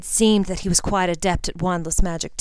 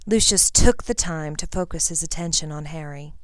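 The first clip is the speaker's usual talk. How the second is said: usual speaking voice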